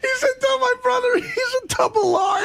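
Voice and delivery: very high squeal of real laughing; barely getting words out through laughter; helpless laughter. Real, not acted. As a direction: voice climbing high as laughter takes over. Helpless laughter retelling the call.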